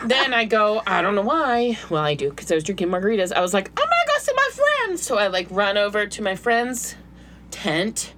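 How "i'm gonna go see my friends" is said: mock tipsy high voice. Drunk self, very high.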